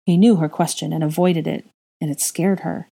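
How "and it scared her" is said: ordinary delivery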